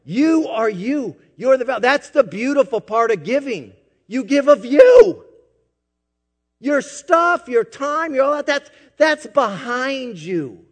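high, urgent preacher's voice; preacher urging the church to give of themselves; impassioned preaching. Acted. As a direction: plead with them to give. Preaching close, raised pitch.

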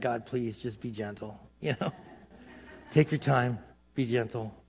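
very soft, low pleading with God; quiet plea. Acted. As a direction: beg gently, barely above a murmur. Imagined plea on the altar.

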